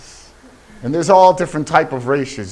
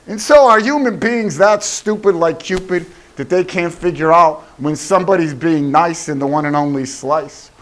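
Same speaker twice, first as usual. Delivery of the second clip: ordinary talk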